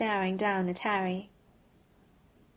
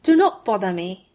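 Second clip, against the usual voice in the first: loud, cold brush-off in a put-on voice; heavy dismissal; 7 dB louder than usual. A narrator's reading. Malfoy turning away from Harry, heavy and cold. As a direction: shut him down hard. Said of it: "Malfoy's tone was heavy".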